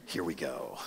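very low, soft, amused murmur; smirking. Retold or imitated. Jesus eyeing the scheming leaders, quiet smirk. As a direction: savour it quietly, almost to himself.

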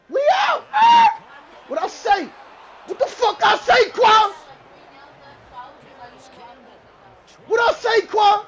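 very high, loud victory yell; explosive, high-pitched gloating holler; triumphant shouting. Real, not acted. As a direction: scream it in celebration. Celebrating a big play.

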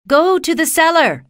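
high urgent shout. Acted. Aunt shouting to Dorothy to hide, loud and high. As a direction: call her down to the cellar fast. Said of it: "she shouted".

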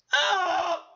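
high mock scream "Ah!"; hammed-up sustained yell; imitated scream. Recapper demonstrates the hero's scream, high mock yell. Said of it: "¡Para gritar!".

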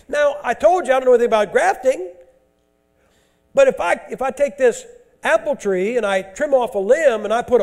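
loud, high scripture preaching; thundering sermon reading; full-force preaching. Real, not acted. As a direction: drive the verses home.

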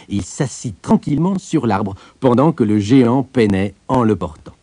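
plain reading voice

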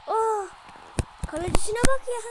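high, loud frustrated outburst; excited yelling. Real, not acted. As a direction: shout at the keeper in disbelief. Frustration at goalkeeper. (yüksek sesle sinirli heyecan)

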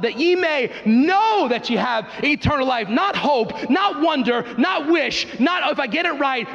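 high, ringing call and response; rising revival preaching; fiery preaching. Acted. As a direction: preach it out, answering each claim. Preaching at full force.

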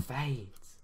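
hushed, drawn-out fade; fading away. Shown as a demonstration. Reader showing the effort put into the book, fading. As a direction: let the word die away softly. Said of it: "I actually put effort into this part".

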